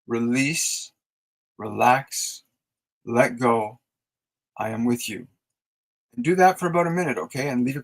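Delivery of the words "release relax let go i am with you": calm the feeling with each word; soothing mantra. Shown as a demonstration. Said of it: "communicate that directly".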